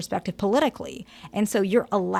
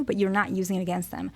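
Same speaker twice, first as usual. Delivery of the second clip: ordinary talk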